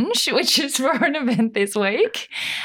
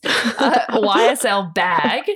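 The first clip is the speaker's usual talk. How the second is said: ordinary talk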